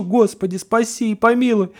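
high, pleading cry to God; desperate plea. Acted. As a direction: beg for mercy in terror. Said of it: "только кричу". (отчаянная мольба высоким голосом)

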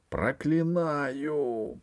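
slow, drawn-out high curse; cursing. A narrator's reading. Dying empress cursing the usurper, slow drawn curse. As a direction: lay the curse slowly. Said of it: "пронесла громко императрица".

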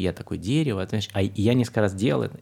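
normal talking voice

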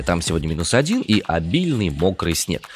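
ordinary talk

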